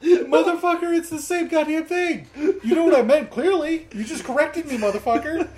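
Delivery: high, loud, laughing outrage; mock indignant tirade, laughing; laughing rant. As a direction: rant while cracking up. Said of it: "I was like".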